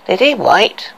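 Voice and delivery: contemptuous, sneering question. Acted. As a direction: sneer out the name. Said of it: "asked the queen sneeringly".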